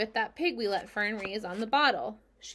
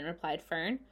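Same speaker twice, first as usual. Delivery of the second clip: usual speaking voice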